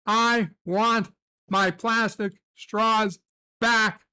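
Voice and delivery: "I want my plastic straws back" drawn out, word by word; slow, insistent complaint; slow and deliberate. Shown as a demonstration. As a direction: spell it out slowly like a demand.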